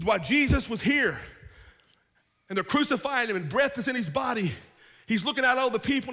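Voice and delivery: forceful preaching. Acted. Preacher telling of truth condemning those Jesus touched, forceful preaching. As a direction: preach it out with insistence, pitch lifted.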